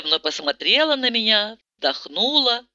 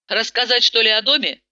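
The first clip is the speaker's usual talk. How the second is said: usual speaking voice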